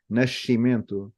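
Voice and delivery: "nascimento" with sc as sh and final o as u; Portuguese "nascimento", sh sound, final u. Shown as a demonstration. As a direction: say "nascimento" as "nashimentu". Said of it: "more like sh instead of j".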